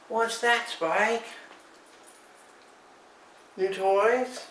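high sing-song pet talk; dog-directed voice. Real, not acted. Talking to the dog.

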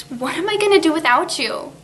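ordinary delivery

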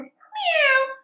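high loud meow; cat meow. Acted. Reading to kids, the cat meowing high.

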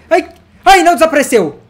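high, loud yelp of relief; ai ai, it didn't vanish!; surprised relief. Real, not acted. Relief the diamond stayed.

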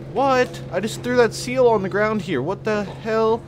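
high, loud alarmed disbelief; high, loud what the hell. Real, not acted. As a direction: freak out at the vanishing seal. Seal vanished.

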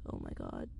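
a strange, sunken, very low murmur; weird voice. Real, not acted. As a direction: voice drops oddly low. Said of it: "My voice is so weird there".

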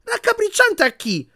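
very high, drawn-out retort; indignant outburst. A narrator's reading. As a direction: burst out, deeply offended. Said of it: "sbottò Ron".

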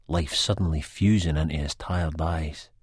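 ordinary delivery

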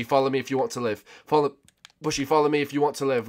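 usual speaking voice

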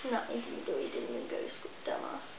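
muttered aside. Real, not acted. Mock scolding the dog.

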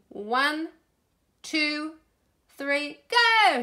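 high count building to a loud GO; excited, high-pitched countdown; shouted countdown. A narrator's reading. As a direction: count them in, then shout go. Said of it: "shouted Peppa".

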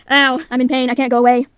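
ordinary talk

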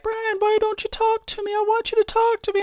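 very high falsetto plea; mock pleading coworker. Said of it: "you're gonna be like".

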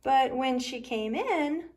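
usual speaking voice

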